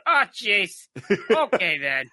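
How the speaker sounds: loud, high Minnesota "Ah, jeez. Okay, then."; hammed-up Upper Midwest twang; broad Minnesota accent. Shown as a demonstration. Comic showing off his Minnesota accent to his co-host. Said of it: "my Minnesota accents up here".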